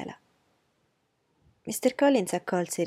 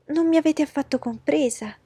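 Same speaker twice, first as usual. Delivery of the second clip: high, loud alarmed outburst; a mother panicking at the match slipping away; 8 semitones higher, 8 dB louder than usual. Acted. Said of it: "disse missus Bennet allarmata". (allarmata, voce alta e concitata)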